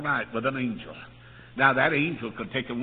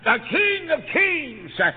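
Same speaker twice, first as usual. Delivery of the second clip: high revival climax, stretched on LORDS; triumphant preaching shout; 13 semitones higher, 6 dB louder, slower than usual. Real, not acted. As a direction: proclaim the titles at full voice. Sermon climax proclaiming Christ's titles.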